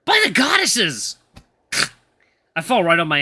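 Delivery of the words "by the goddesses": high, loud, drawn-out cry of shock; startled yelp of an oath; terrified exclamation. Acted. Eli sees the supposed ghost and trips, crying out in fright.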